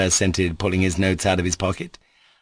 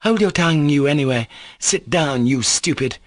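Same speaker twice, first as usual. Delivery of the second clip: loud, sharp annoyed scolding; a woman furiously scolding; angry scolding; 7 semitones higher, 3 dB louder than usual. A narrator's reading. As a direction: snap at him to sit down. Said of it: "scolded with angry annoyance".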